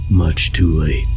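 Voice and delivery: usual speaking voice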